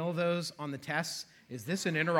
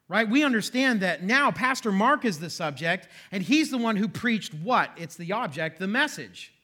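normal talking voice

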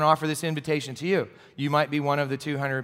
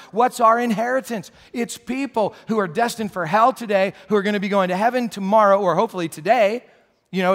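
louder, higher, driving preacher voice; preaching at full force; 6 semitones higher, 6 dB louder than usual. Acted. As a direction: preach it out, building the point hard.

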